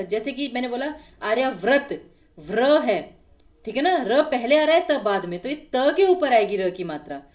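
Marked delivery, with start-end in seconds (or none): none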